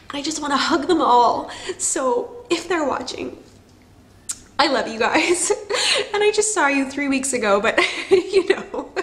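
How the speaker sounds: emotional. Real, not acted. Vlogger to her family watching, choked up. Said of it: "I get very emotional".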